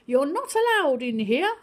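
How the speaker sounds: a teacher sending the cat out; teacher telling off. Acted. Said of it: "Miss said".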